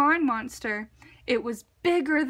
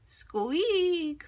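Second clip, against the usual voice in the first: whispered squeak; 5 semitones higher than usual. A narrator's reading. Lantern sound in story.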